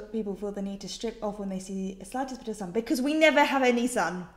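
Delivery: ordinary talk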